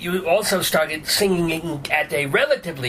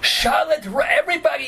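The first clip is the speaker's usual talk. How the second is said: excited exclamation; 9 semitones higher than usual. Real, not acted. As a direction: blurt it out, thrilled to remember. Excited recognition of beloved pastry.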